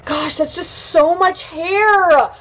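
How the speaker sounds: slow, high, drawn-out wail; aghast wail. Real, not acted. Vlogger staring at the cut hair, aghast wail.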